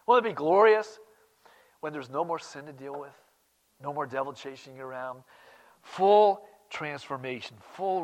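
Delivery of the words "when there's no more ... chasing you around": ordinary talk, nothing marked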